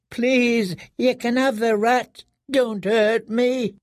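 high, slow, cowering plea; broken prisoner. A narrator's reading. Broken prisoner pleading with his jailers, high and cowering. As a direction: beg like a broken captive.

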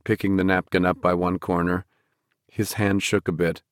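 usual speaking voice

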